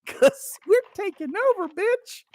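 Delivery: high mocking taunt; taunting Scots mates. Teasing Scottish drinking buddies in a high mocking voice. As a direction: rub it in, voice jumping high on bitch. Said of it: "I'm like".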